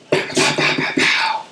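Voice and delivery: soft breathy pow pow gunfire; imitated gunshots. Hunter imitating the locals shooting at the slough. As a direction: mimic distant rifle shots under the breath.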